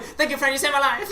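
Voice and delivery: high, loud imitation of a thick foreign accent; broken English accent. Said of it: "he said it exactly like this".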